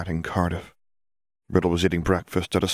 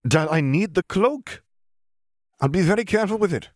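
pleading son; 12 semitones higher than usual. A narrator's reading. Son asking his father for the cloak, higher and hurried.

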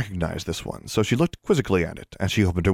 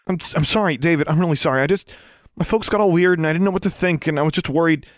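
anxious apology; 8 semitones higher than usual. A narrator's reading.

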